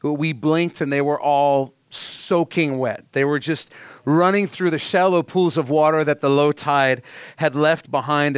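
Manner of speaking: usual speaking voice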